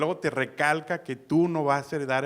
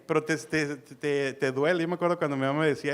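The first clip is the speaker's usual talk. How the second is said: usual speaking voice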